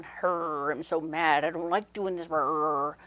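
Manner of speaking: low, loud growling wolf; snapping wolf. Retold or imitated. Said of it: "the wolf will be snapping at you, going".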